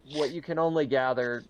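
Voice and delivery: normal talking voice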